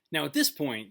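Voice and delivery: ordinary delivery, nothing marked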